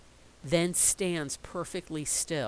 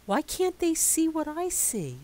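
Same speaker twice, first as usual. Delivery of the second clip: put-on wondering child; 12 semitones higher, 4 dB louder than usual.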